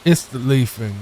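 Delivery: hammy mock German accent; stagey, badly faked German. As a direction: play a cartoon German chef. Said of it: "It's not how you speak German".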